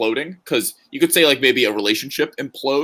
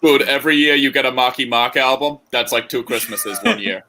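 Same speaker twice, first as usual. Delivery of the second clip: put-on Boston accent; 2 semitones higher than usual. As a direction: lean into a thicker Boston accent. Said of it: "Better better than that".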